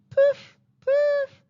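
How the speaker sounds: high, loud imitated kettle puffs. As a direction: puff like a blowing kettle, very high. Voiced sound effect of kettle.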